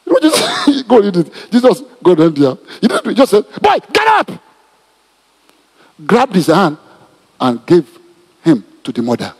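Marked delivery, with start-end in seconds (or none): 3.6-4.3 commanding shout. Acted. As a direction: call the dead boy up with full authority. Jesus raising the dead boy.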